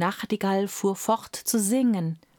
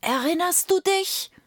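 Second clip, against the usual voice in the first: much higher, eerie rising question; second ghostly whisperer; 11 semitones higher than usual. A narrator's reading. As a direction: another spectre taunting, pitched right up. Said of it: "flüsterte einer nach dem andern".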